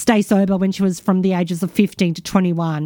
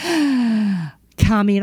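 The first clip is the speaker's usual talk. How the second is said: usual speaking voice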